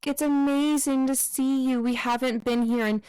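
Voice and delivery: high, bright fake-polite party voice; gushing guests. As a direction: gush over them like a sugary hostess. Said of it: "they're like".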